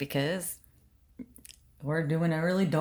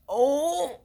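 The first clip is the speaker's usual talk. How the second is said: high startled oh; surprised gasp; 9 semitones higher, 4 dB louder than usual. Real, not acted. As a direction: gasp at the mess on your face. Notices glitter all over face.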